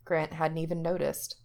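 usual speaking voice

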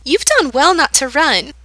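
put-on menacing girl's sweet approval, high; sweetly. A narrator's reading. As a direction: praise him like a pet, too sweetly. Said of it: "the girl continues sweetly".

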